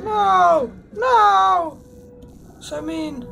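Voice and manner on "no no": loud high anguished no; dismayed no. Acted. Player watching bullies take the ball, loud dismayed cry.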